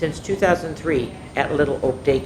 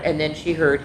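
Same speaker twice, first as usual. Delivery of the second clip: plain reading voice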